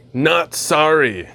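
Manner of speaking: drawn-out, punchy NOT SORRY, lightly voiced; slow, emphatic mock ad line; Seth Rogen impression. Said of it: "Like Seth Rogen from that Reese's commercial".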